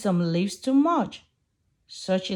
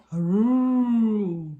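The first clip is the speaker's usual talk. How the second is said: silly trumpeting call; elephant trumpet; 3 dB louder than usual. Acted. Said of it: "makes a silly trumpet sound".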